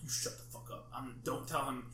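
hushed, harsh whisper to a friend; urgent whisper. Retold or imitated. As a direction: hiss it so nobody else hears. Hushing friend during movie.

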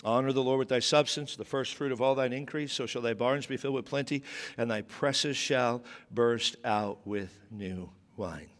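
low, hushed solemn verse reading; measured preacher's recitation; scripture reading. Acted. Reads the Proverbs verse.

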